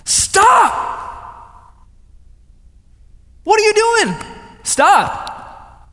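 high, loud re-enacted shout; full-force shouted confrontation, pitch way up; yelling. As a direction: yell at them to stop. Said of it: "I yell at them".

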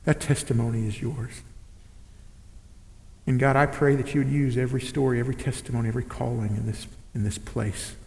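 soft, low, earnest prayer; closing prayer. Acted. Pastor praying softly over the church. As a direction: pray it quietly over the congregation.

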